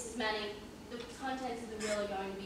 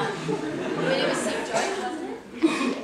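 laughing; 10 semitones higher, 10 dB louder, slower than usual. Acted. Inspector laughs at joke.

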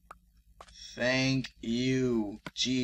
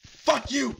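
high angry curse; 13 semitones higher, 7 dB louder than usual. Real, not acted. Cursing the mob that killed him.

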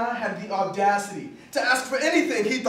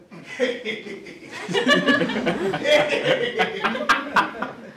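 long mock-villain cackle; drawn-out hammed-up evil laughter; 3 semitones higher than usual. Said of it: "He started laughing, like an evil villain".

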